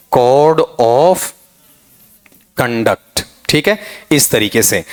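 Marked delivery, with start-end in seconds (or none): 0.1-3.2 "Code of conduct" split up, pausing long before conduct; plain "kohd of", then a separate, falling "conduct"; common faulty pronunciation. Shown as a demonstration. Teacher mocking how we mangle English, common faulty pronunciation. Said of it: "क्या धज्जियां उड़ाते हैं अंग्रेजी की अपन".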